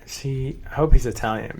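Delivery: usual speaking voice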